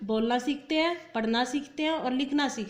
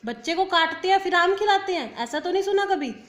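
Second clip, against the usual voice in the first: raised, louder voice of disbelief; mock disbelief; 6 semitones higher, 4 dB louder than usual. Shown as a demonstration. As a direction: point out the absurdity to the class. Disbelief at absurd wrong sentence. (बनावटी हैरानी से बोलना)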